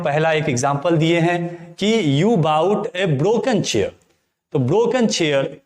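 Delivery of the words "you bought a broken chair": drawn-out, deliberate reading of the example; slow dictation. Shown as a demonstration. Teacher reminds students of an earlier example, reading slowly. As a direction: read it slowly so students can copy it.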